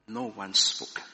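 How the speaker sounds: ordinary talk, nothing marked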